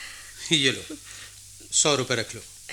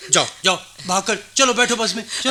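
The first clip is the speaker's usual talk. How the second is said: much higher, louder hurrying call; urgent calling out; 9 semitones higher, 4 dB louder, faster than usual. Acted. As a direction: chase them onto the bus. Sending boys onto the bus. (ज़ोर से पुकारते हुए जल्दी करवाना)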